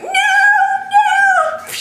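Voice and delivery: high, loud cartoon wail; imitated little voice crying out in protest; background voice wailing. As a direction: wail it in mock distress, drawn out high. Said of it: "this voice in the background going".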